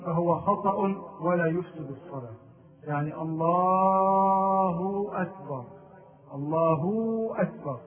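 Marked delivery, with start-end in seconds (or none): 3.2-7.8 "الله" drawn out with its final hu stretched to "hoo" before "أكبر"; lengthened haa. Shown as a demonstration. Teacher showing the wrong madd on the haa of "الله". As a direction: hold the ending of "الله" as a long hoo. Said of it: "يعني المد بالهاء".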